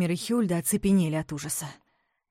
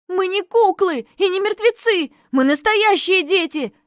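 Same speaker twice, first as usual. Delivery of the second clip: put-on high, loud angry girl; furious child shout; 13 semitones higher, 9 dB louder than usual. A narrator's reading. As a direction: yell it back at the bear. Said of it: "закричала она сердитым голосом".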